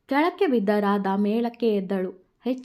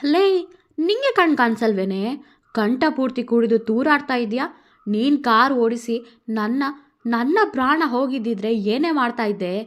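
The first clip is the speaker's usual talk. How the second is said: Radha yelling; 3 semitones higher, 6 dB louder than usual. Acted. Radha yells at the drunk woman driver, angry. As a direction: tell off the drunk driver hard.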